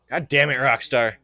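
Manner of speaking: loud, high burst of frustration; exasperated shout. Real, not acted. Player cursing Rockstar over unskippable dialogue, exasperated shout. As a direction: yell it at the developer in exasperation.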